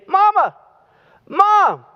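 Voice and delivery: put-on high small boy's voice calling out from bed; little boy calling. Little boy calling his mother from bed, high childlike voice. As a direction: pitch way up like a kid calling his mom.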